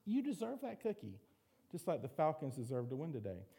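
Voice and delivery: high, coaxing tempter voice, fading to a hush; tempting little voice. Retold or imitated. A preacher voicing the temptation to eat the last cookie, high and coaxing. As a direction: coax yourself toward the cookie in a high, sly little voice. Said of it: "all these little voices".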